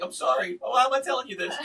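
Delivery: high-pitched, overwrought apology; retold distraught apology. Husband apologizing to the store lady after he lost it, retold distraught apology. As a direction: act out apologizing mid-meltdown. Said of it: "I just lost it".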